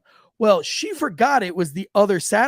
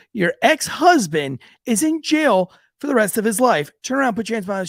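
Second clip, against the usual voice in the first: angry; 4 semitones higher, 5 dB louder than usual. Retold or imitated. Cop arresting lying woman.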